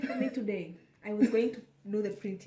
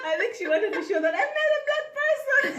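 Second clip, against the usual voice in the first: high and loud through laughter; high laughing disbelief over the selfie; 6 dB louder than usual. Real, not acted.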